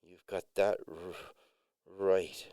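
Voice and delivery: understated nervous hesitation. A narrator's reading. Jared replying to the other man, nervous. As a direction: say it a little haltingly, uneasily. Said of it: "Jared said nervously".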